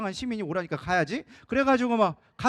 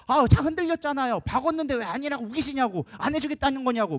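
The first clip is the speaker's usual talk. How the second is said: higher-pitched, exasperated retort that opens loud; irritated officer; 6 semitones higher, 3 dB louder than usual. Retold or imitated. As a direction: argue back in mock irritation. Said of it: "막 짜증을 내면서". (짜증 내며 따지는 경찰 흉내)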